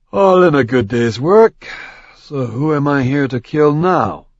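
tossed-off swagger, eager for a fight; Ethon, cocky warrior. Acted. Ethon voiced by narrator.

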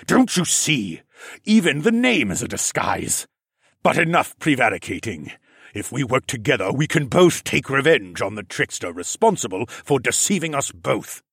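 lightly voiced scheming reptile man; reptile regent. A narrator's reading. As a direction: lay out the accusation coldly. Narrator voices Siskorn.

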